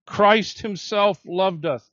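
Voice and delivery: "Christ" hit first and strongest, said slowly; stress on Christ. Shown as a demonstration. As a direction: punch the name "Christ". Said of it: "It's emphatic".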